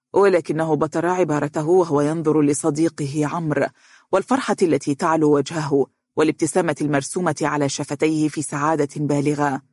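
plain reading voice

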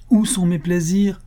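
heated, high-pitched outcry; impassioned outburst. A narrator's reading. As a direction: throw the question out in anguished protest. Same outburst, next rhetorical question.

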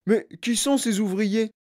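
put-on high boy's voice asking; Augustus Gloop. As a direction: pipe up with a child's question. Boy asks the host.

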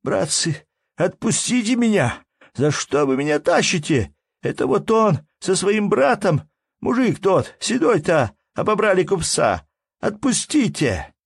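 high, loud, desperate pleading; trembling, broken voice. A narrator's reading. Bound Petrushka pleads with his captors, voice trembling. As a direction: beg them to let you go. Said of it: "он сказал дрожащим, прерывающимся голосом".

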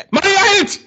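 high, ecstatic commentator yell; excited shout. Real, not acted. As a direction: scream the save with joy. Live goal-call excitement.

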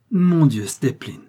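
loud, high burst on the first words; amused exclamation. A narrator's reading. Prince crying out to his steward, highly amused. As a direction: exclaim as if between fits of laughter. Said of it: "s'écria-t-il entre deux éclats de rire".

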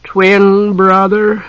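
slow, high yawning words; through a yawn. A narrator's reading. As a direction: stretch the words out into a huge yawn. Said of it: "lost in a prodigious yawn".

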